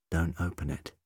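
softly commanding; hushed, serious order; quiet authority. A narrator's reading. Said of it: "His voice was quiet but full of authority".

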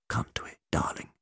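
soft, breathy whisper to a treasured object; murmured. Acted. McCavity murmuring to his medallion, soft whisper. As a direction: whisper it lovingly to the gold. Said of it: "he murmured".

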